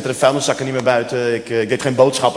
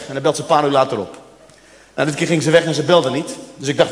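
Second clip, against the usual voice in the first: ordinary talk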